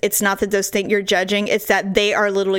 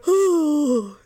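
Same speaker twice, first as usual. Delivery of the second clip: a higher, drawn-out mock yawn; imitated yawn; 6 semitones higher than usual. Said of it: "hear him going".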